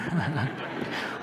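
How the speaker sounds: short rising chuckle at himself; self-mocking laugh. Real, not acted. Preacher laughing at his own fear before the crowd. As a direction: laugh off the worry.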